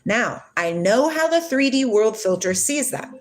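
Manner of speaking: ordinary talk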